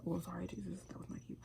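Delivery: hushed, whispered apology; quiet aside. Real, not acted. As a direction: murmur the apology.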